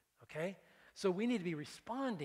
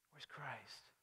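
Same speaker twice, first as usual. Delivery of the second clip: whisper; 3 semitones lower, 12 dB quieter than usual. Acted. Whispered searching question.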